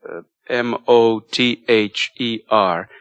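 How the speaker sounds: slowly spelled out letter by letter. Acted. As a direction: spell the forbidden word out slowly. Spelling out a taboo word.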